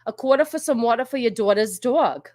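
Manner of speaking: New York accent with dropped Rs; hammed-up New Yawk accent. Shown as a demonstration. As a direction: show off broad New York vowels. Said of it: "like, a New York accent".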